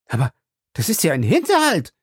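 shouting. A narrator's reading. Passepartout, drunk on brandy, crying out at Fix. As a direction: cry out at the trap. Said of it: "schrie Passepartout".

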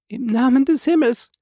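very high, imploring cry; frantic acted begging; desperate plea. A narrator's reading. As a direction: implore him in a high woman's voice. Wife begging for her life.

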